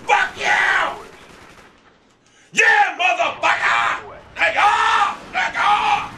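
high, gleeful shouting; triumphant taunting. Real, not acted. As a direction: crow at the beaten opponents. Crowing over kills.

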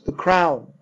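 plain reading voice

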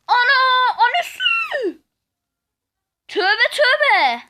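high shocked shout, drawn out; dramatic auntie gossip. Real, not acted. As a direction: cry out scandalized like a gossiping aunt. Doğru tahmine şok tepki. (dedikoducu teyze gibi şaşkın bağırış)

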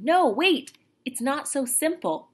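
loud and high, stopping him; alarmed no, wait; urgent. Acted. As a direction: cry out to hold him back. Omri grabbing Patrick.

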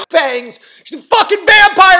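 high, full-volume yell; explosive, high-pitched storyteller's shriek; shouted reveal. Retold or imitated. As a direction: scream out the shock twist. Shock reveal in retold story.

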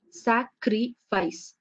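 "sacrifice" said slowly, each of its three syllables apart. Shown as a demonstration. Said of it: "Three syllables means".

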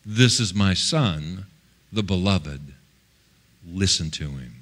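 low, measured voice from heaven; voice of God. Retold or imitated. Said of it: "the voice said".

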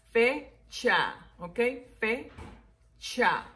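"fecha" split into fe, cha; syllable by syllable. Shown as a demonstration. Teacher counting two syllables, "fecha" broken fe... cha. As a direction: pause between fe and cha in "fecha". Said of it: "dos sílabas".

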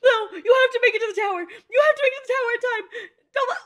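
high, worked-up urging, close to crying; near tears. Real, not acted. Viewer begging Ura to make it to the tower, near tears. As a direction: plead frantically on the edge of tears. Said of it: "I'm gonna cry".

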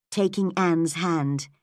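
ordinary delivery

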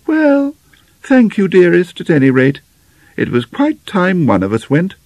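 a mother's high voice, thanking her daughter; placid mother. Acted. Mother's character voice.

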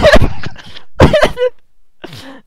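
very high, loud squealing laughter; screaming with laughter; shrieking laugh. Real, not acted. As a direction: dissolve into helpless laughter. Cracking up at the friend joke.

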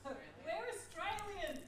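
laughs it out, slow and high; amused, laughing confession. Real, not acted. Listener laughing about Aussie upspeak, laughing.

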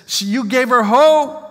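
high, loud overwhelmed mother; mother's amazement. Retold or imitated. Preacher voicing the crying mother, high and loud with wonder. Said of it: "her mother starts to cry".

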